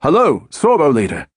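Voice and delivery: put-on North London accent. A narrator's reading. Howell answering the controller in a North London accent. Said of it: "Howell replied in his North London accent".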